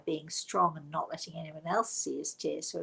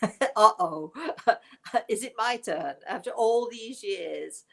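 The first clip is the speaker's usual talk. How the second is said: laughing mock alarm; 3 semitones higher, 6 dB louder than usual. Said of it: "And I'm thinking".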